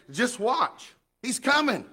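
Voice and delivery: a thrilled, high-pitched promise; eager promise. Retold or imitated. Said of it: "and said".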